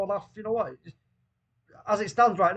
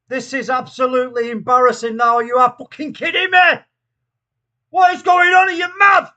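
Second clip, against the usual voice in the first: higher and louder, shouting at the screen; furious disbelief; 6 semitones higher, 13 dB louder than usual. Real, not acted. Streamer watching his team get thrashed, furious shout. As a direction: rant at the players in real outrage.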